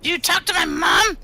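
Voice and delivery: very high squeaky creature voice; high-pitched, startled little creature question; upset little creature. Acted. Gitmo reacts to mention of mom.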